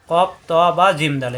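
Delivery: ordinary talk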